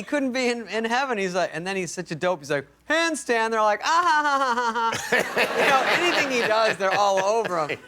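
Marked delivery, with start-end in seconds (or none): 2.9-3.5 mock show-off. Dope showing off to women. 3.8-5.6 high, drawn-out fake laughing; exaggerated swooning "ha ha ha"; fawning mock laughter. Women gushing over the bachelor's handstand, hammed-up fawning laugh.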